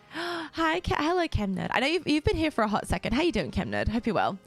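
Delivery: usual speaking voice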